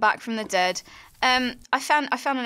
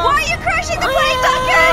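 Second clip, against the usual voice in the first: panicked shout; 7 dB louder than usual. Acted. Acted plane crash panic.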